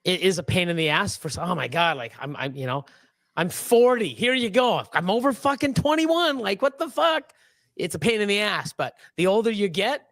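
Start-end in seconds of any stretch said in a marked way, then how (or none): 3.3-7.3 pitched-up, mock-indignant complaint; exasperated protest. Customer protesting an ID check at forty, exasperated. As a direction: climb higher as the disbelief grows.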